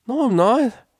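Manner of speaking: high, loud scoff; snorted denial. A narrator's reading. Stallion rejecting his drunk friend's teasing, snorting. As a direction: snort it out in protest. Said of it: "he snorted".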